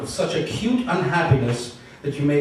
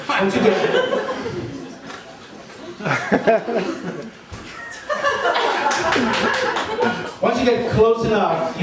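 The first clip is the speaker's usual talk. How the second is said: laughing; 9 semitones higher than usual. Real, not acted. Laughing while reading.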